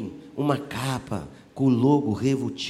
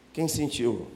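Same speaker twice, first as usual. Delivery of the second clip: usual speaking voice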